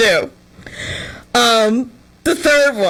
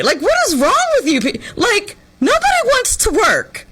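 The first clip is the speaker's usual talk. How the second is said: mock old lady; 10 semitones higher than usual. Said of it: "I'm waving my old lady cane in the air".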